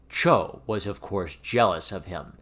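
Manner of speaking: usual speaking voice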